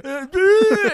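high, loud panicked grunts. Retold or imitated. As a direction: flail in panic. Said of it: "you're like".

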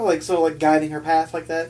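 ordinary talk, nothing marked

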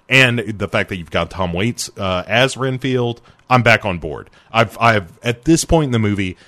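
ordinary talk, nothing marked

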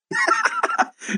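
high burst of laughter. Real, not acted. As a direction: laugh out in disbelief. Laughs at host peeing.

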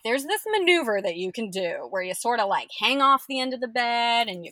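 bright reassuring impression of the midwife; quoting the midwife. Midwife quoted giving instructions.

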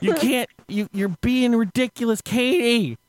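high-pitched exasperated retold yelling; mock scream. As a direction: imitate a man scolding at a yell. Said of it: "He proceeds to scream at her".

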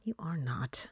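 breathy teasing whisper. Real, not acted. Co-host whispers to sick Paul, you are not, teasing whisper. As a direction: hiss it under the breath, disbelieving.

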